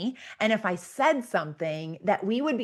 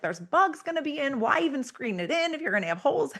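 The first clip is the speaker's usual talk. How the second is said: imitating husband; 7 semitones higher, faster than usual. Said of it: "He's like".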